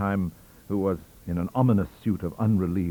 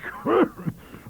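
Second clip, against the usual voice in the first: scornful laugh; 3 dB louder than usual. A narrator's reading. Scornful laugh mid-tirade.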